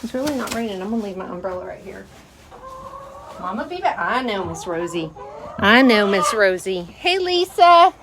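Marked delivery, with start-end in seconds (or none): 3.4-4.0 higher, soft promise to the hens; pet talk. Real, not acted. Telling hens she'll return. 6.8-7.9 higher, louder, slow greeting to a hen; sing-song greeting. Real, not acted.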